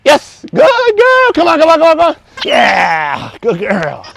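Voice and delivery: very high, loud, drawn-out praise and coaxing; high excited praise. Real, not acted. Trainer gushing and coaxing dog, very high and loud. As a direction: gush over the dog and urge her in. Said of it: "You're so animated".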